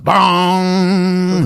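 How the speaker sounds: loud high mock bong; imitated sound sting. Narrator imitating the horror sting, mock bong. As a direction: punch the sound effect.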